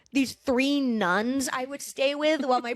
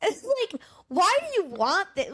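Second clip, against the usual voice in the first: usual speaking voice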